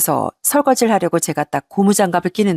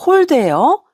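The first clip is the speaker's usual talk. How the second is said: sharp, high, louder disbelieving echo; stunned disbelief; shocked echo; 8 semitones higher, 4 dB louder, much slower than usual. Retold or imitated. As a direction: throw the word back in shock. Shocked at accusation.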